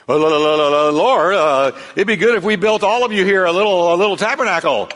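flustered Peter blurting it out; nervous stammer. Retold or imitated. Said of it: "Peter, not knowing what to say".

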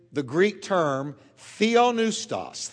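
ordinary talk, nothing marked

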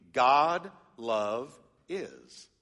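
"God love is" stretched very slowly, each word apart; slow literal reading. Shown as a demonstration. Pastor shows the literal Greek order, very slow. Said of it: "it would be".

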